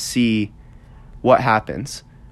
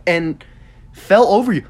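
exasperated; 6 semitones higher, slower than usual. Retold or imitated. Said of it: "he's like".

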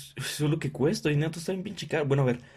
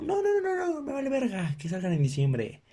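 high squealed no no no; shrill-sounding refusal, mocking her; mock whiny reply; 12 semitones higher than usual. Voicing the pregnant partner replying. (respuesta chillona y burlona)

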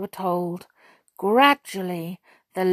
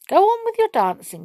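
high gracious queen voice; lightly voiced gentle queen; Queen's voice; 13 semitones higher, 4 dB louder, faster than usual. A narrator's reading. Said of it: "she said".

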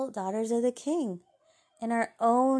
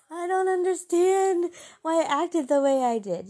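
laughing self-mockery; 9 semitones higher, 6 dB louder than usual. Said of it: "you're just like".

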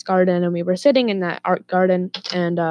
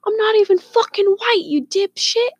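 high, loud mock outrage; high-pitched retold comeback at full tilt; imitated angry retort; 4 dB louder than usual. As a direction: imitate an indignant sister yelling back. Sister snaps back in quarrel.

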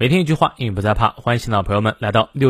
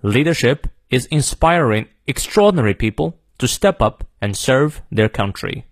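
American English demo reading, slowing to the end; American accent. Shown as a demonstration. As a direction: model the sentence in American English with R's sounded. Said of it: "再来一遍". (美式发音领读)

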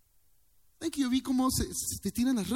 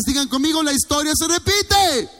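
preacher calling the crowd louder and higher; 9 semitones higher, 9 dB louder than usual. Real, not acted. Preacher rallying the congregation, louder and higher. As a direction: rouse them to repeat it loud.

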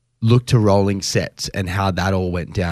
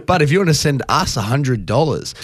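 laughing; 6 semitones higher than usual. Real, not acted. Joking ask for money.

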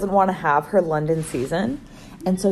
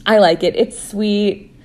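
normal talking voice

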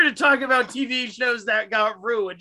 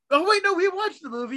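high, quick realization; sudden correction; 9 semitones higher, faster than usual. Real, not acted. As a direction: catch yourself mid-sentence.

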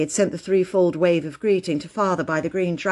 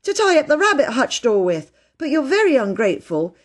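put-on higher, scolding child; indignant; 6 semitones higher, 4 dB louder than usual. A narrator's reading. Phyllis reproaching Peter over her hanky, indignant. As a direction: scold with high-pitched indignation. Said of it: "said Phyllis indignantly".

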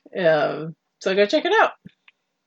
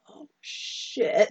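whispered dismay; 6 dB quieter than usual. Retold or imitated. Reacting to the grim finale.

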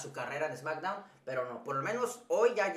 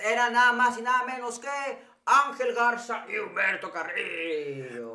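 higher, louder, slow build to the names; mock grand wrestling introduction; ring announcer reveal; 4 semitones higher, 4 dB louder than usual. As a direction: introduce them like a live event announcer.

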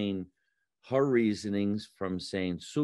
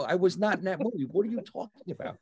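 fast, higher, indignant retort; retold protest; 8 semitones higher, faster than usual. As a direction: rattle it off in mock outrage. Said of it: "I go".

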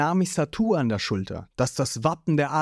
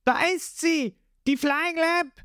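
excited; 14 semitones higher than usual. A narrator's reading. Lysop spots the ship.

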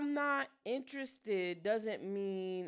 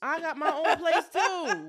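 slow, loud, higher, laughing retort; mocking comeback; 5 semitones higher, 9 dB louder than usual. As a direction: throw the boast back, drawn out.

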